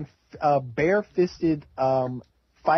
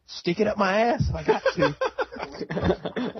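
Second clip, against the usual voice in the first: laughing slow drawl; 6 semitones higher, much slower than usual. Retold or imitated. Voicing Terry, laughing.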